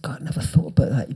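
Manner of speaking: hushed quick whisper to himself; whispered aside. Retold or imitated. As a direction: breathe it out under his breath, quickly. Whispered aside in retold interview.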